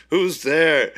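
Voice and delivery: high, loud tense call; loud call into the dark. Retold or imitated. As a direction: shout it into an empty house. Pierce Brosnan searching dark house.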